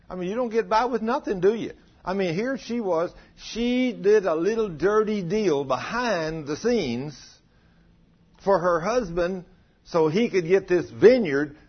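impassioned pulpit rebuke; fiery preaching. Acted. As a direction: drive it home to the congregation. Preaching at full force.

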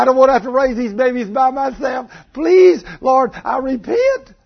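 high, loud begging prayer; frantic acted pleading. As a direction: beg God at the top of the voice, hammed-up. Wife's frantic plea to God acted out.

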